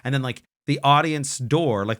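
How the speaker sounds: usual speaking voice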